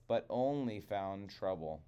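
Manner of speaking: ordinary delivery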